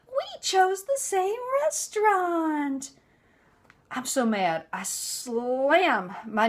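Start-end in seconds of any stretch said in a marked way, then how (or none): 0.0-2.9 high, slow sing-song with a gasp; fake surprised mother-in-law. Mother-in-law arriving at the restaurant, mock delighted. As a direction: gasp and coo like a staged coincidence. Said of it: "I hear in the background".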